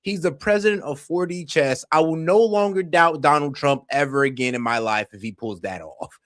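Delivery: usual speaking voice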